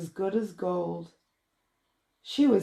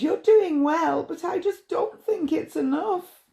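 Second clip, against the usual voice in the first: worried bride; 9 semitones higher than usual. Acted.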